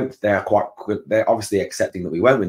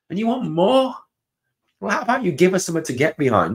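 incredulous; 10 semitones higher than usual. Real, not acted. Fan mocking the manager's request.

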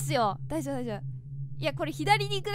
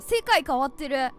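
ordinary talk, nothing marked

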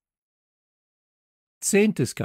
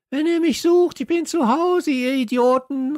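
high, loud jeering call; calling back; 8 dB louder than usual. Acted. Hasso shouts back at the exhausted kids, loud taunt. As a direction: yell it over your shoulder while running. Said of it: "rief er noch".

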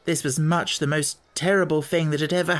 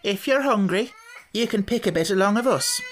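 higher, kindly offer; not unkindly; 7 semitones higher than usual. A narrator's reading. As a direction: offer food gently. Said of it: "not unkindly".

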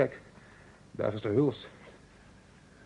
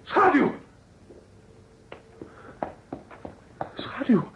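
shout; 14 semitones higher, 4 dB louder, much slower than usual. Acted. Calling out to his companion.

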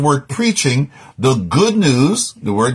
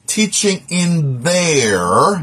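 THEIR drawn out long and higher; stress on THEIR; 4 semitones higher, much slower than usual. Real, not acted. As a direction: stretch out THEIR slowly, rising at the end. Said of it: "emphasizing the word there".

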